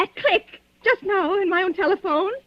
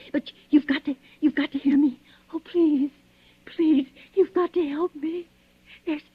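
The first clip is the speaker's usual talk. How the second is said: usual speaking voice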